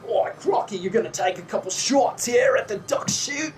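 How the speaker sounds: put-on lightly done Australian accent, called out; hint of an Aussie barker hailing a customer; Australian carny. Australian carny calling Crocky over, light Australian accent. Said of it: "and he says".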